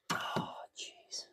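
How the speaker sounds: strained whispered groan; effort grunt. Real, not acted. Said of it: "grunting into the microphone here".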